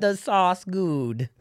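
put-on playful Italian accent riff; mock Italian. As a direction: lay on a jokey Italian accent.

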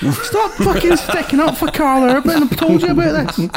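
mock outrage. Mock scolding over Karl Urban.

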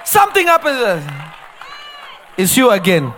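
loud, high shouting sermon; fiery preaching. Acted. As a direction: preach it hard to the congregation. Preaching at full force.

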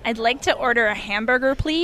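careful put-on American accent, lifting on please. Shown as a demonstration. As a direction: try a neat, nerdy-sounding American accent. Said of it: "can you do an American accent?".

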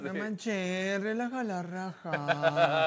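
slow, drawn-out slangy guide voice; mock ñero meditation guide; street-style meditation. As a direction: stretch it out like a rough-neighbourhood guru. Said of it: "Imagínate una meditación ñera".

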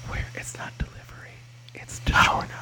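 soft whispered ad slogan; ASMR whisper. Pretend. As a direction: whisper the tagline like an ad. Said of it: "a little A S M R".

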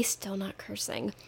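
hushed self-aware aside; whispered aside. Real, not acted. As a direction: whisper it under your breath. Aside whispered under breath.

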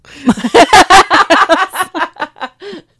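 high, loud, helpless real laugh; real rising belly laugh; tickled laughter. Real, not acted. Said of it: "I was tickled".

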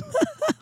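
high soft giggle; trailing helpless laugh; real laugh. Real, not acted. Losing it while retelling the call to listeners, a high giggle. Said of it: "I might start laughing really hard".

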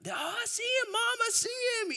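put-on a little boy thrilled to finally see it; excited kid shout. Boy shouting to Mom that he sees baby Jesus, high excited voice. Boys spot nativity scene.

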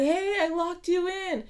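high drawn-out sing-song call into the car; calling out to someone locked in, high and slow; retold yelling. Coworker calls into the car she thinks is occupied, sing-song yell. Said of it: "So I started yelling".

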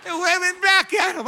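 loud, high-pitched exclamation; incredulous outburst. Retold or imitated. Preacher describing his friendly church greeting the drunk man, incredulous outburst. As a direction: burst out in comic disbelief. Congregation's waving shocks the preacher.

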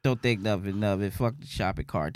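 ordinary talk

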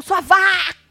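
loud, high drawn-out yell; mock furious insult. Fu insulting Kali in a retold fight, mock furious. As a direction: hurl the insult in a hammed-up rage.

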